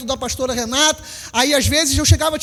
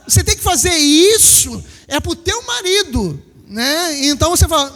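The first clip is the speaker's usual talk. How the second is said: ordinary talk, nothing marked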